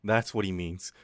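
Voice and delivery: normal talking voice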